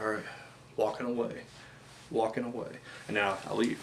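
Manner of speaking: soft, hushed retreat, almost under breath; tiptoeing off. Pretend. Backing away from a masked wrestler, softly murmuring. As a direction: ease away quietly so he stays calm. Sneaking off from calmed Primate.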